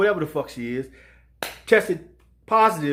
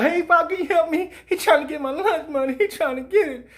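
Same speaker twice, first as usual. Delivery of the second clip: higher mock kid voice begging pop for help; imitated kid asking father for help; kid calling dad; 7 semitones higher than usual. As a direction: play the little kid running home to his father. Kid running to his father.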